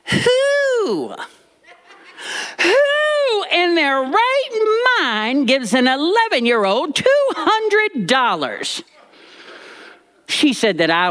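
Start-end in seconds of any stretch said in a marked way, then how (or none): 0.0-8.8 high, slow, outraged granny voice; horrified granny. Retold or imitated. Granny horrified about the money, high outraged voice. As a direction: play granny appalled at the idea. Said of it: "Granny was horrified".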